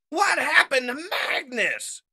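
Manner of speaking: loud, high, slow question; urgent shout. Acted. Wheeljack calls from cover, urgent shout. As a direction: shout over the battle.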